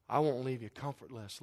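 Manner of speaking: soft comforting promise; tender reassurance. Acted. God speaking into hearts, soft reassurance. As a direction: comfort them quietly, like a father. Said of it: "maybe something like this".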